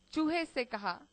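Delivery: ordinary delivery, nothing marked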